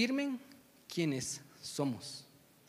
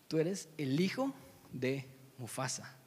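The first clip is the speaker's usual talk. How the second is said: slow, lower, weighty reveal; solemn revelation; 4 semitones lower, 4 dB quieter, slower than usual. Acted. Rafiki telling lost Simba his identity, slow and solemn. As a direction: let it land slowly and deliberately.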